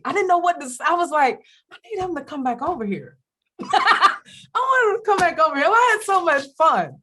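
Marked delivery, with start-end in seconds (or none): 1.7-3.2 quick, high, pleading voice; pining eagerness. Acted. Acting out missing him after he went home, eager pleading. Acts out longing after he left. 3.6-7.0 loud, high, giddy whine; gushing longing. Acted. Acting out missing him after he went home, loud giddy pining.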